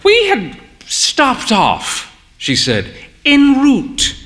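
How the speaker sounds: lightly voiced grand old mother. A narrator's reading. Mother explaining to barman.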